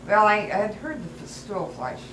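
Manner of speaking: ordinary delivery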